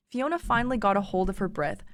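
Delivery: usual speaking voice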